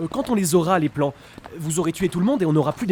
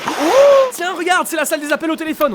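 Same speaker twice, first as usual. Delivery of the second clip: nervous change of subject; 10 semitones higher, 6 dB louder than usual. Acted.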